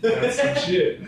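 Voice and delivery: loud, high-pitched mock amazement; high, punchy put-on reply; impressed reaction. Acted reply in retold chat.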